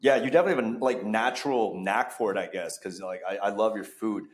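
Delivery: ordinary talk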